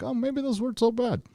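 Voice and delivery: high, small put-on voice; squeaked-up mock second thoughts; mock reconsidering. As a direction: imitate a fan timidly reconsidering. Voicing fans' grudging second thoughts.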